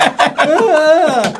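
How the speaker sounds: high, loud startled squeak; panicked student's squeak; high flustered yelp. Retold or imitated. Student at a loss.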